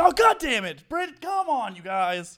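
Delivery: high, loud frustrated yell; exasperated shout. Retold or imitated. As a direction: blow up in frustration.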